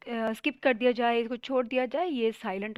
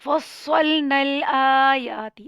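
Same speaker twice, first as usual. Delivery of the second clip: slow, louder melodic tajweed recitation; slow Quran recitation; 9 dB louder, much slower than usual. Shown as a demonstration. Quran teacher reciting the verse for students, slow and melodic. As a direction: chant it slowly with full tajweed. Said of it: "آپ نے یہاں فصلنا نہیں پڑھنا".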